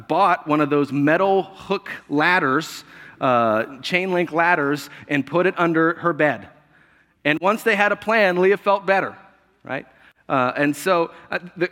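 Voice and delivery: usual speaking voice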